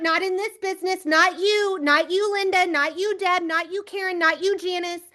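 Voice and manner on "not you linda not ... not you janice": normal talking voice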